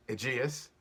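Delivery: ordinary delivery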